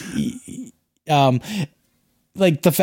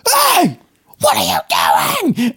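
high-pitched mock scream of outrage; imitated screaming rant; 11 semitones higher than usual. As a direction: scream it like an actor blowing up on set. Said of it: "screaming at him".

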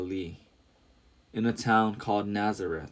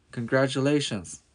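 cried out; 4 semitones higher, 3 dB louder than usual. A narrator's reading. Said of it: "she cried as she got closer".

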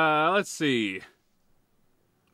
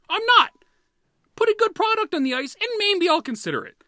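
heated rant; 10 semitones higher, 4 dB louder, faster than usual. Real, not acted. Heated rant at Flyers owners.

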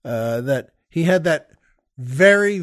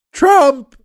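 very high, booming imitated holler; one-word scream; 10 semitones higher, 9 dB louder than usual. Retelling a man shouting across a parking lot, screamed. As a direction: belt out one word like the stranger. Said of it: "he shouted again".

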